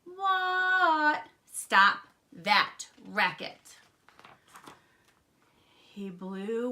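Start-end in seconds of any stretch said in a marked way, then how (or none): 0.0-1.3 high, loud, stretched answer; yelled reply. A narrator's reading. Froggy answering Dad's yell, high loud holler. Froggy yells back to Dad. 1.5-3.8 loud, slow, spaced-out command; scolding dad. A narrator's reading. Dad fed up with the saxophone, slow loud scolding.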